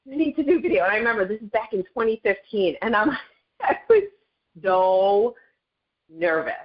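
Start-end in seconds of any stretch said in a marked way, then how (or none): 0.0-0.8 mentor insisting. Retold or imitated. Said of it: "she's like".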